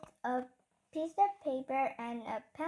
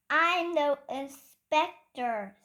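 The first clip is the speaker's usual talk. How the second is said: bossy proclamation; 9 dB louder, slower than usual. A narrator's reading. Henry bossing the teacher.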